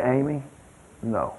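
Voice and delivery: a quiet no that needs no raising; quiet firm no. Retold or imitated. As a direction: grandpa quietly correcting the child, low and soft. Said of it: "I say".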